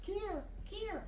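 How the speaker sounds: very high, soft kier kier; imitated bird call. Showing birders what they'll hear, a high call. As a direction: whistle the murrelet's thin call. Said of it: "You'll hear this".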